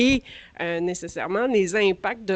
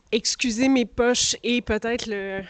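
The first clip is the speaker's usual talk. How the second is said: normal talking voice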